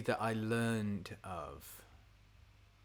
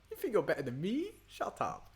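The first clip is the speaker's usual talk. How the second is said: quick mock challenge, pitched up, then a breathy "shut up"; imitating a friend; 8 semitones higher than usual. Retelling how bandmate [name] quotes [name], lightly mock taunting. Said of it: "And [name], ever since, has gone".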